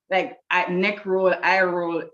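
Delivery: ordinary talk, nothing marked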